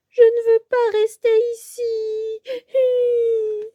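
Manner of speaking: a tiny mouse crying in the hole; sobbing mouse. Acted. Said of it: "sanglote Souris". (souris qui sanglote, voix aiguë)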